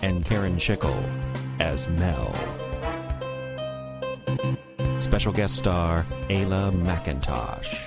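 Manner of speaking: measured announcer read with big pauses; show title announcer. Acted. Announcer introducing cast and episode, deliberate title read. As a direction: present each credit with a flourish.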